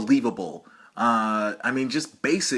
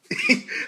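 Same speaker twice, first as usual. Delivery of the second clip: high-pitched laugh. Pretend. Laughing during pretend call.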